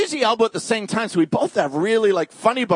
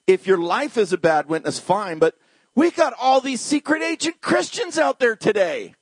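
normal talking voice

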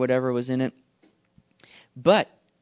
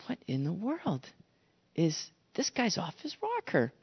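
hushed, incredulous aside; baffled soldier; 11 dB quieter than usual. Acted. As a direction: say it softly, in slow disbelief. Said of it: "Could you imagine the soldier now, his reaction".

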